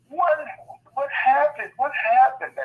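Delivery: put-on alarmed kids asking. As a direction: imitate the kids rushing up, alarmed. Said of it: "they're like".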